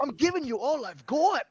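lightly voiced strained engineer; Scotty from Star Trek. Fan quotes Scotty to the group, lightly voiced. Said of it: "That's Scotty from Star Trek".

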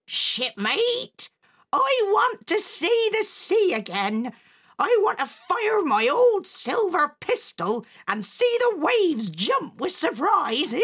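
put-on high, loud old pirate woman; old pirate mother. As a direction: boom it out like a rowdy old sea dog. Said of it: "his mother said".

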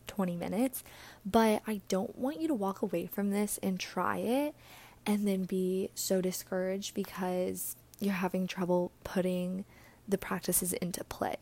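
ordinary talk, nothing marked

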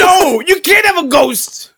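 high, slow shouted impression; Danny DeVito. Co-host as Frank Reynolds refusing a ghost, high drawn-out shout. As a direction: shout it high, dragging every word. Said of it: "It's my Danny DeVito impression".

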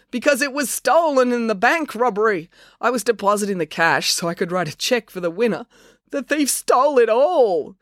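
high-pitched explanation rising to a lament; distressed confession. A narrator's reading. Pained Chelsea confesses prize was stolen.

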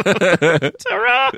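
high, jaunty ta-ra; posh top hat farewell. Retold or imitated. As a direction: sing it out like a toff tipping his top hat. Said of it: "Like he's got a little top hat".

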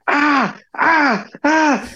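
high, mock panicked shrieks; imitated wife's scream. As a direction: squeal like a shocked wife. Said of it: "I just hear my wife screaming".

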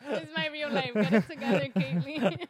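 loud, helpless laughing through the words. Real, not acted. Joking with co-host about a spin-off show, laughing. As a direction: crack up while saying it.

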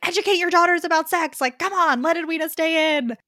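high, loud exasperated plea to the book; mock scream at the page. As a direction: yell at the characters like they can hear you. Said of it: "screaming at the page".

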